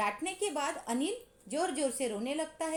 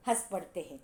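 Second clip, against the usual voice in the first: ordinary talk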